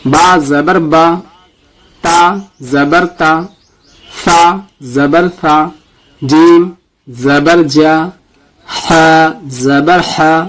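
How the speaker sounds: slow, deliberate letter-by-letter spelling; slow recitation drill. Shown as a demonstration. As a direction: spell each letter for students to repeat. Teacher leads letter recitation drill. (آہستہ قاعدہ پڑھانا)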